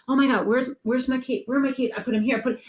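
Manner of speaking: panicked. Retold or imitated. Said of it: "they start going".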